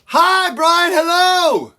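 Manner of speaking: loud, bright call of hello. Shown as a demonstration. Teacher leads the class in a loud greeting. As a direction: project it to the back row. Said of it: "Louder, louder, go".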